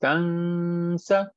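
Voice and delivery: तंसा with the n hidden and drawn out before sa; nasal ikhfa. Shown as a demonstration. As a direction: hold a long nasal hum in तंसा. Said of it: "हम छुपा रहे हैं".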